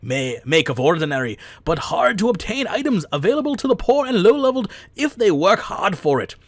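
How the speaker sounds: high, loud mock-Irish, drifting into Scottish; hammed-up Irish accent with big pitch swings; bad Irish accent. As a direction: read it out in a badly faked Irish brogue. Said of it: "I'm digging the accent regardless of it".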